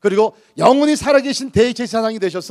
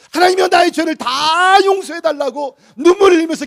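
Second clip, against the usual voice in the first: louder, higher, voice cracking with tears; tearful outcry; 8 semitones higher, 8 dB louder than usual. Real, not acted. Preacher reliving his first tearful prayers, crying out loud. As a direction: cry it out as if confessing again.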